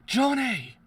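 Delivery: loud, high, desperate call; shouting a name. Real, not acted. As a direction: call out to him across the field. Calling out to fallen ally.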